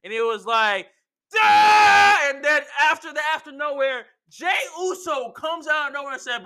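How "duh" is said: high, loud attack cry; imitated strike yell. Acting out the enforcer striking Sami, high yell. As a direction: yell it out like landing a blow.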